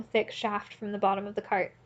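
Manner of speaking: plain reading voice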